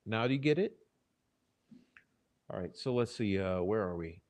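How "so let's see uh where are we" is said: ordinary talk, nothing marked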